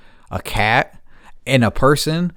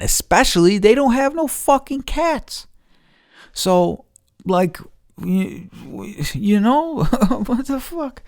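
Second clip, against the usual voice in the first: high-pitched, half-laughing what the fuck; disbelieving; 12 semitones higher than usual. Real, not acted. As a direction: rise up high in amazed disbelief, chuckling. Neighbours had no cats, disbelief.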